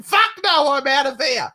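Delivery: high, loud blurted refusal; mock alarm. Everyone fleeing Moscow over conscription, in mock alarm. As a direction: burst out like someone fleeing in panic. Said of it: "everyone's going".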